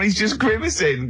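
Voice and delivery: ordinary talk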